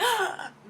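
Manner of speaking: high delighted gasp; mock gasp of happy surprise. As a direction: gasp with joy. Nora reacting to pregnancy news.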